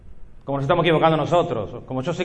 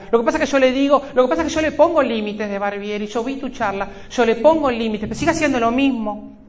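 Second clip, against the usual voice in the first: higher, whiny parent voice; complaining parent; 8 semitones higher than usual. Retold or imitated. Parent complaining to the speaker in a higher whiny voice. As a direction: play the frustrated parent who blames the talk.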